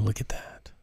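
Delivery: quick whispered aside. Real, not acted. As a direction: breathe it to yourself. Aside while setting up.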